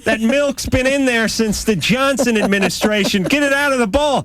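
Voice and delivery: high-pitched, exasperated retort; hammed-up squawk of disgust; mock protest. Host replays his complaint to his girlfriend, mock protest. Acted quarrel over rotten milk.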